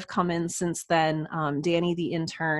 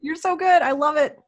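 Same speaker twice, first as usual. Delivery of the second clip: higher and louder, delighted; gushing praise; 11 semitones higher, 7 dB louder than usual. Real, not acted. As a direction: gush with delight at the host.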